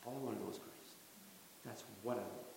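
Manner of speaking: soft, hushed words of a personal prayer; quiet prayer. Pretend. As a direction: speak it softly to yourself, like a prayer. Congregation invited to say it.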